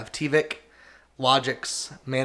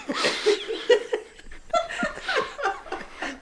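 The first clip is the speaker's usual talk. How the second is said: airy high-pitched laugh; high breathy laugh. Real, not acted. Laughing at the Junkrat quote.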